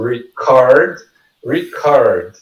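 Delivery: record as the verb, "ri-CORD"; stress on CO. Shown as a demonstration. Teacher explaining stress marks, record stressed on the second syllable. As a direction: put the weight on CORD in record. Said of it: "co é a sílaba mais forte".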